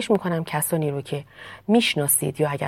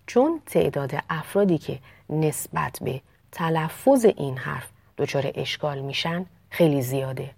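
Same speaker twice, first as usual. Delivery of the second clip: ordinary talk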